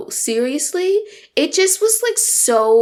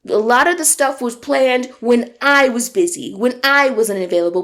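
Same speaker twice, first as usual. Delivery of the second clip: ordinary delivery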